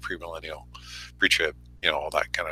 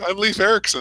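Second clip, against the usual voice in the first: mock Scottish Viking; 11 semitones higher than usual.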